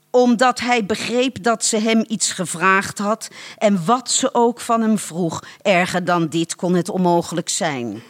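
usual speaking voice

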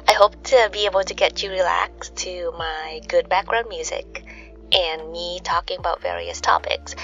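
soft sleepy whisper. Acted. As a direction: whisper it to lull. Sleep podcast soft intro.

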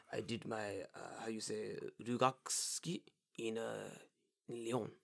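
put-on soft, hesitant French-accented English. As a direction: play a quiet fisherman with a French accent. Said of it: "English with a French accent".

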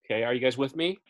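normal talking voice

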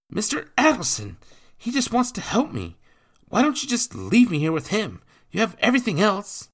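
put-on high, loud young boy begging; a boy desperate to be left behind; pleading boy. Boy to his stepfather, high pleading boy. Jacques begs to stay.

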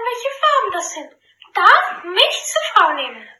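high, proud royal command; haughty princess voice. Acted. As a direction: announce it like a smug princess. Princess sets riddle. (stolze Prinzessin, laut verkündend)

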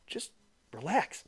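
soft unvoiced plea to calm down; whispered aside. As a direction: whisper it under your breath. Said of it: "it's like".

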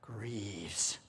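ordinary talk

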